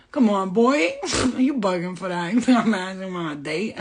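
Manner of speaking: loud sassy self-scold, laughing; scolding herself. Retold or imitated. Telling herself off about the cute guy, sassy laughing scold. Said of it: "And I'm like".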